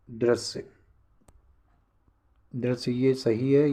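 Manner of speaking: ordinary talk, nothing marked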